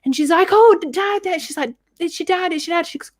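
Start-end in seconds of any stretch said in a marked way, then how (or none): 0.5-1.4 high-pitched tipsy mum impression; drunk mum. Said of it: "really drunk on New Year's Eve". 2.0-2.9 high, excitable tipsy mum; drunk mum. Retold or imitated. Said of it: "really drunk on New Year's Eve".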